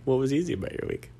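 eager and interested; caring. Pretend. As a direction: ask like you truly care. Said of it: "Ask me like you care".

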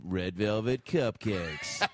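slow and sexy. Pretend. Podcaster voicing a spoof cupcake commercial, slow and sexy. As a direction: draw it out like a seductive ad. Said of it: "Slow and sexy".

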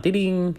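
high sung "Ding, ding"; magic wand chime. Retold or imitated. Coach mocking a quick-fix magic wand with a chime.

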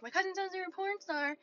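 high, soft put-on voice; mock club guy. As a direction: imitate him in a small, high voice. Mimics the guy's opener.

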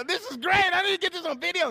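high, loud and thrilled; excited. Retold or imitated. Pastor retelling watching the kittens, excited and loud. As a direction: sound delighted, reaching for the camera. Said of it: "and I go".

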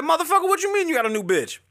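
high, fast mock outrage from his son; son's angry quote. Son cursing out his dad over a new girlfriend, high fast mock outrage. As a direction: squeal it like an indignant grown son. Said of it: "He said".

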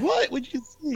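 very high, startled questioning; retold high-pitched "what?"; acted incredulous outburst. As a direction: burst out in mock disbelief. Retold incredulous shouting at friend.

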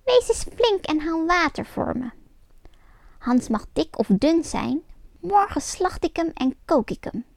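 a witch who will wait no longer; impatient witch ordering Grietje. A narrator's reading. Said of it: "riep ze".

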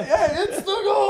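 high, laughing goal call; mock goal call. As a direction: shout the goal through laughter.

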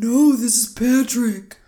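loud dopey Patrick Star voice; Patrick impression. Playing Patrick on the phone, loud and dopey. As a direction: yell it like Patrick answering the phone. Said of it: "Is this the Krusty Krab?".

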